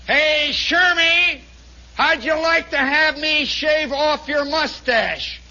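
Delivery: slow, high, loud holler; drawn-out jeering shout; taunting challenge. Acted. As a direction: call out the threat, stretching every word. Tormentor's loud climactic threat.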